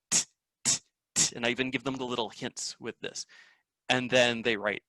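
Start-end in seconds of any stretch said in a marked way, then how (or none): 0.1-1.3 unvoiced "t", repeated; whispered t sound. Shown as a demonstration. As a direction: breathe the "t" sound. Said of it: "And I go".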